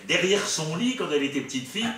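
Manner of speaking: normal talking voice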